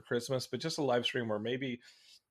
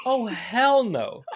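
loud, high, drawn-out mock outrage; hammed-up indignant no; emphatic refusal; 8 semitones higher, 7 dB louder, much slower than usual. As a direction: refuse it slowly and loudly, playing it up. Acts out indignant text reply.

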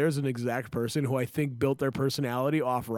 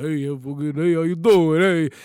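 loud Rocky-style street mumbling to himself; Rocky calling out to nobody; Rocky impression; 6 dB louder than usual. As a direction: talk to yourself like Rocky, loudly. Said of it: "he walks down the street just talking to himself. He's like".

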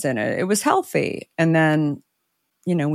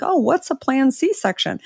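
puzzled question; 8 semitones higher than usual. Retold or imitated. Clueless clerk quoted.

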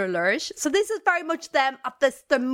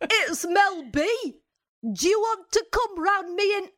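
bad [name] impression; 7 semitones higher than usual. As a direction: drag it out in a bad Northern English accent. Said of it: "You sound like, what's his name?".

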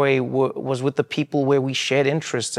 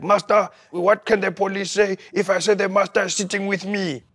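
lightly voiced grandfather, higher pitched; mock the old man's worried excuse, pitched up; imitating grandfather; 7 semitones higher than usual. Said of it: "Be like".

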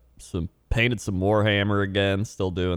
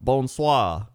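French greeting; 3 semitones higher than usual. Shown as a demonstration. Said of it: "or if I was to say".

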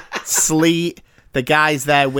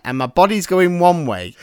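ordinary talk, nothing marked

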